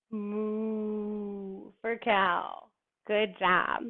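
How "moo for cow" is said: very slow, soft, drawn-out mooing; cow moo. Shown as a demonstration. Teacher to kids in cow pose, slow soft moo. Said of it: "for cow moo".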